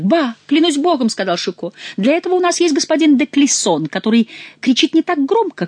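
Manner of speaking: high, loud mockery; mocking. Chicot mocking Schomberg's bravado, high and loud. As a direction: needle him.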